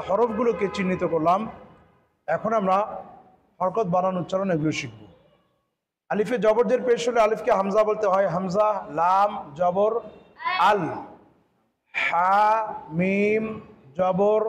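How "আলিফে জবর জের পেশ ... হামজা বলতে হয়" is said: ordinary talk, nothing marked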